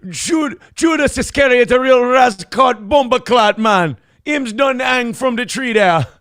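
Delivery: Jamaican patois shout. Retold or imitated. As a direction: yell it in a hammed-up Jamaican voice. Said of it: "and him say".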